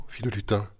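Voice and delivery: usual speaking voice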